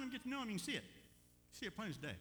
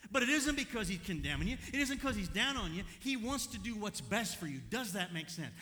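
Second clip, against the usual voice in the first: loud, raised, driving preacher delivery; full-force preaching; 7 semitones higher, 9 dB louder than usual. Real, not acted. Preacher telling church he's not down on us, full-force preaching. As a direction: preach it hard to the congregation.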